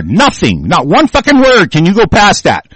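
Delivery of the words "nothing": normal talking voice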